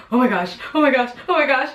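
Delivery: quick, rising oh my gosh over and over; excited. Retold or imitated. Her water just broke, thrilled and gushing. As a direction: gush the words out in a thrilled rush. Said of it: "I was so excited that my water broke".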